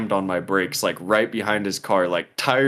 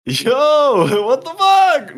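very high, loud, laughing yo; laughing mock bro call; 5 dB louder, much slower than usual. Mocking his friend's way to call her, high laughing yell. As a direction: shriek it through laughter. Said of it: "mocking friend's version".

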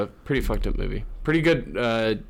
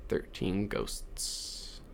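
"Thirteen Ghosts" whispered very slowly and softer; spooky whisper; 10 dB quieter, much slower than usual. Shown as a demonstration. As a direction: breathe "Thirteen Ghosts" eerie and slow. Repeats title in spooky whisper.

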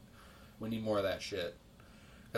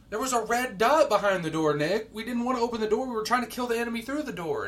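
high, loud retold protest; exasperated arguing; 8 semitones higher, 5 dB louder than usual. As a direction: argue back at a clueless teammate. Retold argument with teammate.

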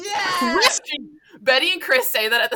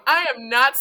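ordinary talk

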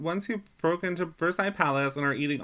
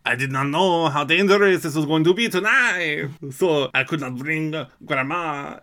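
put-on French-accented grandpa, louder; 6 dB louder than usual. TK's grandpa explains about grandma, put-on French accent. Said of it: "'cause T K's grandpa was like".

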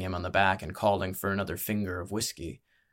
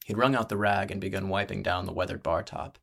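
usual speaking voice

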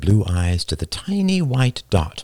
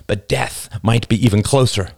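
ordinary delivery, nothing marked

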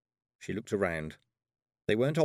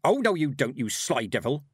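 higher, louder shout at the door; 4 semitones higher, 4 dB louder than usual. Acted.

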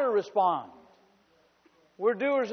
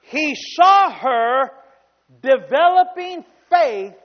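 loud, slow, stretched preaching; full-force preaching; 4 semitones higher, 10 dB louder, slower than usual. Acted. Preacher proclaiming to the congregation, loud and slow. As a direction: drive each phrase home to the church.

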